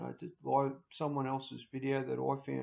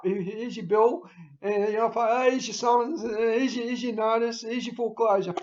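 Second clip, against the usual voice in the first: a little higher and louder, rattling off each notice; mock bailiff listing papers; 6 semitones higher, 7 dB louder than usual. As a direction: deal out the papers one by one, smugly. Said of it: "I got him lined up".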